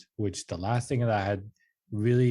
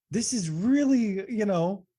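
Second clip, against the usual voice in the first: much higher, louder put-on whine of disbelief; mock incredulous; 12 semitones higher, 4 dB louder, slower than usual. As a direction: play it as an exaggerated, squeaky protest. Mocking his own protest.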